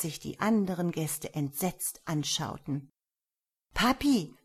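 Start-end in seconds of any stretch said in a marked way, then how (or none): 3.7-4.3 high, loud little daughter; loud child's call. A narrator's reading. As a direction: call out to daddy. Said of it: "sagte die jüngste Tochter des Herzogs laut".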